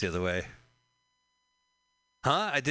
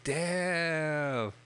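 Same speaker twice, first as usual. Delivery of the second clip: mock damn; 6 semitones higher than usual. Voicing associates' reaction.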